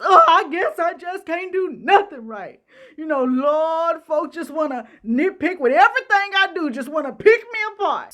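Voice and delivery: high, whiny fake sobbing with laughter; hammed-up self-pity; mock waterworks. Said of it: "Here come the tears and waterworks".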